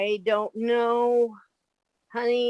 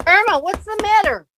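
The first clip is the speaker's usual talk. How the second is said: alarmed; 9 semitones higher, 4 dB louder, faster than usual. Acted. Jane startled by scream.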